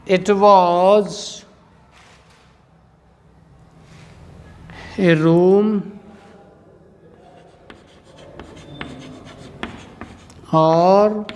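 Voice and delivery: very slow, word by word with long pauses; drawn-out words, long gaps between phrases; dictation. Shown as a demonstration. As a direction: dictate it slowly for students to copy. Teacher dictates answer for writing.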